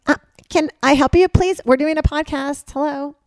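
loud, high call to someone outside the room. Real, not acted. Interrupting the podcast to call out at the ice machine noise, loud. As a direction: project it out the door to the noisy stranger.

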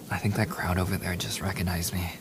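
hushed, urgent whisper so the crowd won't hear. Real, not acted. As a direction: whisper it close, trying not to be noticed. Noticing fans, hiding from them.

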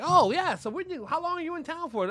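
high-pitched, eager chatter; imitated excitement. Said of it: "they're very excited".

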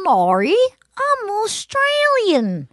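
slow, high, hammed-up Australian accent. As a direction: stretch it into a cartoonish Australian. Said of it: "You could be Australian".

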